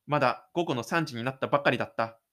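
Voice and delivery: usual speaking voice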